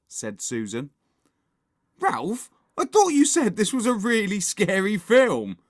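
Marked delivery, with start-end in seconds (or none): 2.0-5.6 high, loud accusation; accusing hiss. A narrator's reading. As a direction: confront a friend sharply. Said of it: "hissed Henry".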